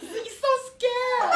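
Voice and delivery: high, very slow frightened English whine; mock scared whimper. Friend whines in English about the crocodile photo, high and slow. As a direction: drag it out like a scared child. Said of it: "กลัว".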